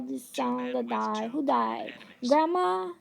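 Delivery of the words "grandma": usual speaking voice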